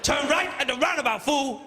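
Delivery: high, forceful celebrity sat-nav voice; hammed-up tough-guy command; Mister T. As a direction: bark directions at a hapless driver. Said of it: "I've got Mister T on mine".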